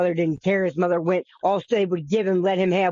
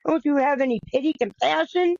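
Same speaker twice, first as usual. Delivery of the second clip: mother pleading; 7 semitones higher than usual. Retold or imitated. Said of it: "His mother goes".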